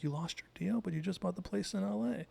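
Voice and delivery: mock-incredulous, pitch lifted; incredulous mom. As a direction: lightly imitate a mother questioning bad news. Retold mother questioning daughter's news.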